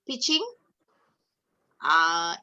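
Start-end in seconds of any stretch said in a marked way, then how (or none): none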